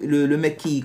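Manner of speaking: ordinary delivery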